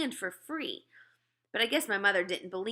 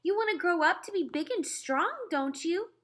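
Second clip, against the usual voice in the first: high, sweet, coaxing mother voice; a mother sweet-talking her child into eating; mother urging her little son to eat, coaxing; 10 semitones higher than usual. Acted. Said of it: "she said".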